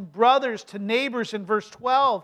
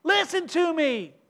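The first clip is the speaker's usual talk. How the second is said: put-on parent to child; 6 semitones higher than usual. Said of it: "We do that with our children".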